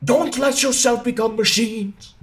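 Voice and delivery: high, slow, rousing orator; orating Chaplin speech. Retold or imitated. Streamer quoting the famous monologue, rousing and slow. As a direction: declaim it like a great film speech. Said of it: "the first thing I think of is".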